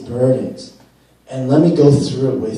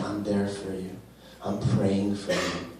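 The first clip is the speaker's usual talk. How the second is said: much softer and lower, close and comforting; soft reassuring Christ; 5 semitones lower, 14 dB quieter than usual. Pretend. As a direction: hush it down like a reassuring promise. Christ voiced comforting believers.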